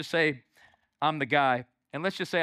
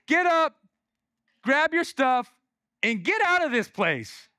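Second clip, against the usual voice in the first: loud, raised, spaced-out order; firm command; 8 semitones higher, 5 dB louder than usual. Acted. Jesus commanding the man to walk, firm and loud. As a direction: order him onto his feet, phrase by phrase.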